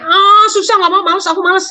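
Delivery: high whining refusal; whiny lazy kid. Retold or imitated. Anak malas menolak tugas. (anak merengek malas dengan suara tinggi)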